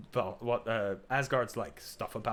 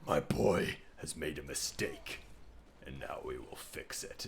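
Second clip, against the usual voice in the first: grave, final, protective; stern deep Kratos; 9 semitones lower, 5 dB quieter than usual. Retold or imitated. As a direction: Kratos defends his son to the gods, low and stern. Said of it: "Kratos just goes".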